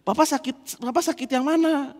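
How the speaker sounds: suara anak kecil dibuat-buat, lebih tinggi; high child voice. Retold or imitated. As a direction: tirukan anak kecil yang bertanya dengan semangat. Son's voice again. (anak kecil bertanya ke papanya, suara tinggi bersemangat)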